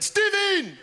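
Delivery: very high exclaimed name; mock Chinese mum. Mum sitting her son down, high mock exclamation. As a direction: shriek the name like a cartoon mother. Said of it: "'Cause she's Chinese".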